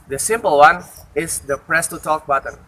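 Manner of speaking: ordinary delivery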